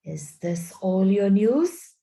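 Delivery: slow, cruel witch; a queen's slow, cruel question. A narrator's reading. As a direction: savor it slowly and cruelly. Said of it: "A slow, cruel smile".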